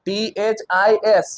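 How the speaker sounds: slow, letter-by-letter spelling. Shown as a demonstration. Teacher shows the class the spelling, spelling out slowly. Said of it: "spelling જોઈ લેજો".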